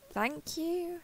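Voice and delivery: soft and tentative; hesitant, bashful return of a compliment; shy, flustered thanks. Acted.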